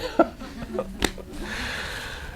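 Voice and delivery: high, airy giggle; real laugh. Real, not acted. Parent laughing at waiting for the crisis, high giggle. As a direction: laugh at the long wait.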